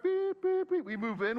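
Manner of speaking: high reversing-truck beeps; mock truck beeping. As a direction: play the moving truck backing in. Imitating moving truck reversing.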